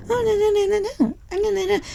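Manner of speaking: high, soft mocking whine of no; mocking whiny no. Coworker mocking her at the gym, high soft whine. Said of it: "like, mocking me".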